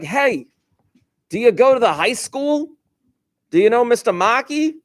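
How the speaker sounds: Brooklyn dad, pitched well up; major Brooklyn accent. Retold or imitated. Dad asking teenagers about his son's school, Brooklyn accent. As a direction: mimic a Brooklyn dad quizzing strangers. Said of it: "a major Brooklyn accent".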